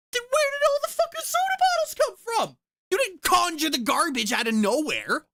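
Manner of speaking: shrill, loud disbelief, voice shooting high; incredulous rant. Retold or imitated. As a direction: rant at him like you can't believe it. Ranting at the father.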